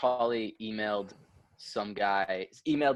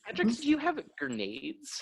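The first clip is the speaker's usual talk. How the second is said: higher-pitched stern mom voice; mock stern mom; 13 semitones higher than usual. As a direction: play the suspicious mother confronting her son. Mom questioning her son.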